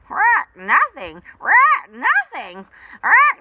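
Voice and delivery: very high, loud squawked What; shocked parrot. Acted. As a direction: squawk in disbelief. Parrot reacts in disbelief.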